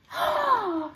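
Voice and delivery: high startled gasp of realisation; sharp gasp. A narrator's reading. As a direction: gasp as it dawns. Amelia realises truth.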